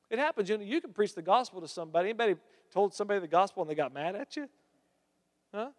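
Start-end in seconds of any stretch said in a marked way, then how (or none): none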